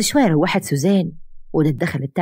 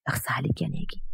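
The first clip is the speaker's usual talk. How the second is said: breathy hurt whisper of blame; whispered reproach; 9 dB quieter than usual. Acted. Wife reproaches absent husband.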